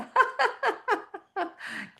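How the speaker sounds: long, high forced laughter; deliberate high cackling laugh; high exercise laugh. Shown as a demonstration. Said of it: "Clean it with laughing".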